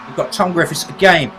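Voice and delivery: normal talking voice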